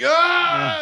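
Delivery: high, loud mock yell of pain; stabbed cry. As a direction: cry out like the man being stabbed. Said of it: "and he's like".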